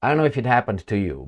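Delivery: ordinary delivery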